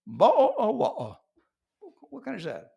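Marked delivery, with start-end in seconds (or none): 0.1-1.2 "Bo-, oh" with a glottal gap where the T was; Ts skipped. Acted. Comedian mocking T-less Brits, exaggerated glottal stops. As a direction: exaggerate "wa-, oh" with only vowels left. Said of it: "They just skip the tea altogether".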